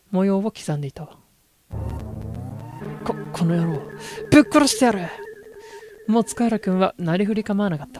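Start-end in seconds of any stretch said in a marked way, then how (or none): 3.1-5.1 murderous threat. Acted. Wounded man threatens.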